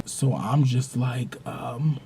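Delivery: ordinary talk, nothing marked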